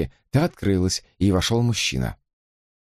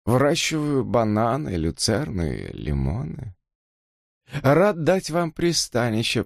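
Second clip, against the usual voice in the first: thin reedy voice; 2 semitones higher than usual. Acted. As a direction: rattle it out unpleasantly. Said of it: "неприятным дребезжащим голосом".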